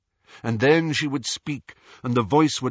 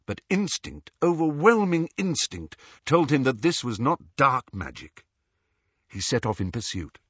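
ordinary delivery, nothing marked

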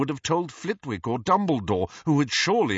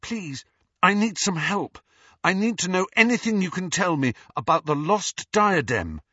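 higher, urgent plea for help; pleading; 9 semitones higher, 5 dB louder than usual. A narrator's reading. Harry begs the ghost for help, pleading. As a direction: beg them for information.

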